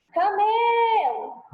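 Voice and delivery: "camelo" called out, middle syllable high and very long; calling out the word. Shown as a demonstration. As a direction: draw out the ME of "camelo" like calling someone from afar. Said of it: "chama a palavra". (chamando "camelo", com o ME agudo e alongado)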